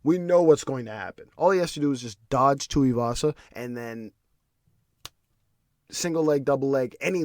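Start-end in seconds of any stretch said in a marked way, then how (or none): none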